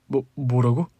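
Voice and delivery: halting, stammered question; flustered stammer. A narrator's reading. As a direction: stumble over the first word, taken aback. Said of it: "남편은 말을 더듬거리며".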